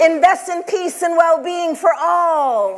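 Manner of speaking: high, slow prompt; emphatic call-out. Acted. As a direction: call it out slowly to the crowd. Prompting audience call and response.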